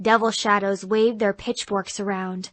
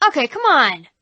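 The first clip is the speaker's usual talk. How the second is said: louder, higher annoyed; exasperated devil; 4 semitones higher, 6 dB louder than usual. Acted. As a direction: break character to scold. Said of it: "the devil said to them".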